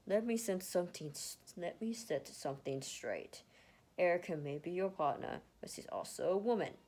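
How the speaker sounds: loud, forceful lecturing voice; stern warning. Acted. Ned sternly lecturing Lucas about treating Erica. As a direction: lay down the law firmly.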